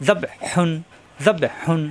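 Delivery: জাব্বে হুন with a tight throaty h on হুন; pressed throat h. Shown as a demonstration. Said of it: "কর্ণ হলতে চাপ দিয়ে". (জাব্বে হুন, হুন-এ কণ্ঠে চাপ দিয়ে)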